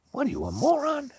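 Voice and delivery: high, loud mock outrage; hammed-up exasperated yell; imitated angry driver. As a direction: fling the insult incredulously. Driver insulting her in acted quarrel.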